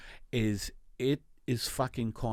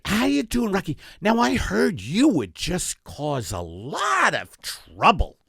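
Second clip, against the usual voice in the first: mock talk show host; 5 semitones higher, 4 dB louder than usual. Mock host interviews bully.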